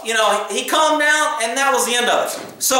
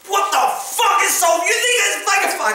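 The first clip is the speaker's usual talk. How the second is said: much higher, furious yelling; enraged shout; 8 semitones higher than usual. Retold or imitated. Man yelling because the cop laughed, enraged shout. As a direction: fling it at him, outraged he is laughing.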